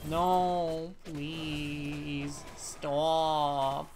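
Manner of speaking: put-on small, drawn-out babyish plea; mock pleading. Player jokingly begs in the game, babyish mock pleading. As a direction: play a pitiful little whimpering beg. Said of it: "I don't know why I said it like that".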